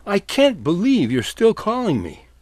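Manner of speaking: ordinary delivery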